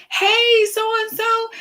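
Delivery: high, singsong hello; cheerful greeting. Acted. Acts out a friendly greeting.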